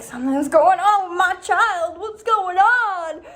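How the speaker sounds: high, drawn-out alarmed wail; mock panic. Expectant mother retelling her scare at the scan, in mock panic. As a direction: play up the panic, stretching it out.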